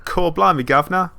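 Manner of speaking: loud, high hammed-up Cockney; exaggerated Cockney cheer; mock Cockney. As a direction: belt it out like a cartoon Cockney. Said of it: "Little bit of a Cockney accent".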